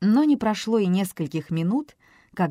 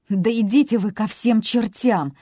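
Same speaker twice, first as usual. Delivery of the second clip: sobbing outburst; 5 semitones higher, 6 dB louder than usual. A narrator's reading. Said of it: "всхлипнула Дэйна".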